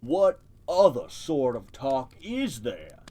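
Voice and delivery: a lightly deepened slow turtle voice from the mud; deep voice. Acted. Turtle in the mud lecturing the mice, lightly deepened voice. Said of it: "said the voice".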